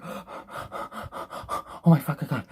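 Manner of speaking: gasping, breathy, slowed-down mockery. Reviewer mocks the diver wasting breath, slow and gasping. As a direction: fake a dramatic gasp, drawn out. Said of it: "being like".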